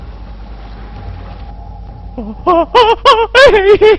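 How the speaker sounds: high nervous laugh-wail, swelling; rising panicky wail. Real, not acted. Player seeing the enemy, rising panicky laugh. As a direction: start small and rise into a shriek. Frightened by returning enemies.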